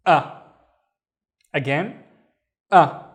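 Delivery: sharp "a" with a glottal catch; hamza with fatha. Shown as a demonstration. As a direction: clip the "a" with a glottal stop. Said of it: "the way to pronounce the hamza is basically".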